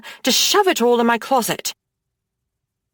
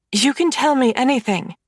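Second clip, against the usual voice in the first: normal talking voice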